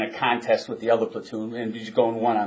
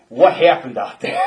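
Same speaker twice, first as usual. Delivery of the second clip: sharp, louder barked question; angry demand; 7 dB louder than usual. Retold or imitated. Sergeant confronting the recruit, louder demand. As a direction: snap the question at him. Sergeant scolding after embarrassment.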